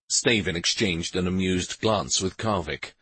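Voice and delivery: plain reading voice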